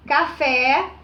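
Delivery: café with the final "fé" held long and rising; stress on last syllable. Shown as a demonstration. Teacher showing the class where café's accent falls, stressed last syllable. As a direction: lean on the "fé" of café. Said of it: "A última sílaba, né, recai".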